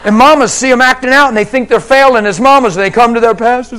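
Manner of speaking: loud, high, fervent preaching; full-force preaching. Acted. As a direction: preach it out to the crowd.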